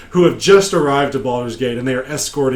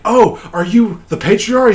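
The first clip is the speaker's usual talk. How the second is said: high, louder mock awe; acted wide-eyed surprise, pitched up; surprised question; 8 semitones higher, 5 dB louder than usual. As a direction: ask as if suddenly impressed. Player reacts to a famous name.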